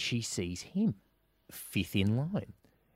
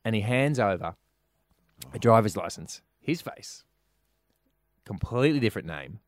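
ordinary delivery

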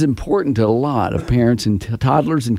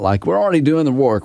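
ordinary talk, nothing marked

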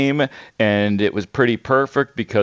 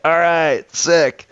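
higher, pumped-up fanboy cheer; stoked at the answer; a touch of giddy excitement; excited fan; 7 semitones higher, slower than usual. Retold or imitated. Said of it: "I'm like".

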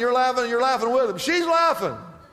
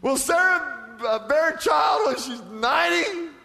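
high, astonished question; incredulous Abraham; 6 semitones higher than usual. Acted. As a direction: act out amused disbelief, peaking on the age. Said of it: "he's just down there laughing".

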